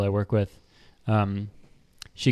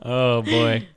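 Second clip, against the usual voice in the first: usual speaking voice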